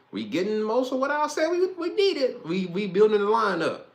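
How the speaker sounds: high, loud hyped voice; pumped up about the lineup; hyped fan; excited. Real, not acted.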